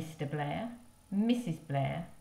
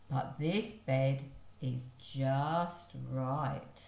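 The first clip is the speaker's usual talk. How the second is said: slow satisfied; 4 semitones lower, slower than usual. Acted.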